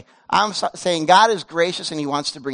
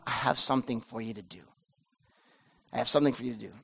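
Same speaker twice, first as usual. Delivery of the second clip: quiet promise; 9 semitones lower, 11 dB quieter, faster than usual. Acted. God's quiet promise to Elijah.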